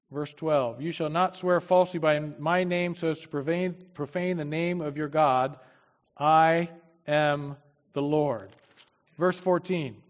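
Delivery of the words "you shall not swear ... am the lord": louder, solemn Bible reading; preacher reading God's words to the congregation, louder and solemn; scripture reading. Acted. Preacher reads Leviticus to his congregation, solemn and louder.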